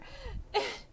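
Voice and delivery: high squealing laugh; shrieking laugh. Real, not acted. Storyteller cracking up at her own story, squealing laugh.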